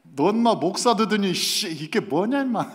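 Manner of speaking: mocking friend. Friend hits him, mocking.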